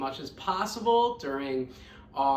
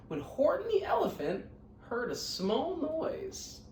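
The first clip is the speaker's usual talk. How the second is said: lively, sing-song storybook reading; reading to children; 4 semitones higher than usual. A narrator's reading. Teacher reading Horton Hears a Who to her scholars, lively storybook voice. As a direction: read it out warmly to a class of kids.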